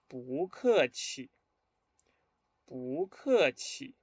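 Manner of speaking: "Bu ke qi" very slowly, syllable by syllable; model pronunciation. Shown as a demonstration. Teacher has students read along, slow model pronunciation. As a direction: draw out "Bu ke qi" for learners. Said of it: "primero a pronunciar estas palabras".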